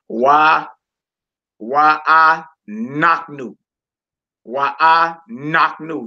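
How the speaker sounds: "knock" punched in a slow, syllable-by-syllable reading; stress on KNOCK. Shown as a demonstration. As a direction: stretch it out and lean on "knock", not "new". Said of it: "I'm putting the emphasis on the knock part".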